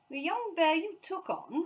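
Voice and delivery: high, slow incredulous question; disbelieving repeat. A narrator's reading. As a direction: echo it, unable to believe. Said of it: "repeated Sir Huntley ... hardly believe his ears".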